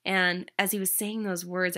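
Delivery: ordinary delivery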